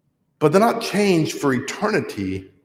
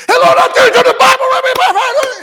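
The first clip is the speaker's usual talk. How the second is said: much higher, loud laughing mock preacher shout; booming preacher voice; 8 dB louder than usual. Mocking the timid guy turned booming preacher. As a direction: burst into a pulpit bellow. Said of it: "He'd walk up, and the first thing he'd do".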